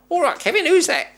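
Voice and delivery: high, loud mock quizmaster; quizzing collector. Imitating the collector testing him on film frames, high and loud. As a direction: bark the test question high and loud.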